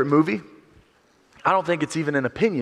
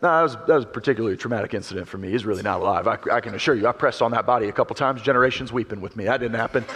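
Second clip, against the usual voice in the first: low fast deadpan Simba; 6 semitones lower, faster than usual. Retold or imitated. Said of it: "Simba says".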